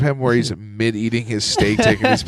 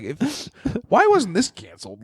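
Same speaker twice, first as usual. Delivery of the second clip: high, incredulous outburst; as if asking in disbelief; 12 semitones higher, slower than usual. Retold or imitated. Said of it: "where I'm like".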